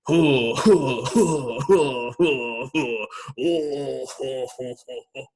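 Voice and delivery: a big, long, deep giant's laugh; deep drawn-out Santa laugh. Shown as a demonstration. Host demonstrating the giant laugh for the group. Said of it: "a deep, like, kinda, like, drawn out".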